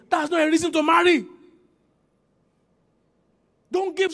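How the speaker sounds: high, emphatic pulpit exhortation; preaching at full force. Real, not acted. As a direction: ring out each warning to the congregation. Preaches warning to congregation.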